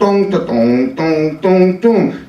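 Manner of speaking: sung ringtone rising in pitch; mimicked cell phone melody climbing up; ringtone imitation. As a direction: chime it like a cell phone ring. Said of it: "I hear".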